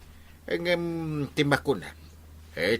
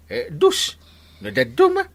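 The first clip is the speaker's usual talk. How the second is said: excited; 10 semitones higher, 6 dB louder than usual. Acted. Excited bragging over coin amounts.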